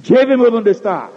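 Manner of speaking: firm voice of Jesus. Acted. Jesus pressing Martha, commanding voice. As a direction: repeat the demand with force.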